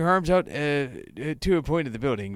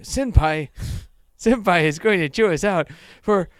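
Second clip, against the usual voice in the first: put-on high, louder girl voice, mildly urgent; hurried girl; 7 semitones higher, 5 dB louder than usual. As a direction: urge your friend along in a raised voice. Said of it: "she says hurriedly".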